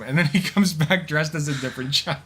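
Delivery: ordinary delivery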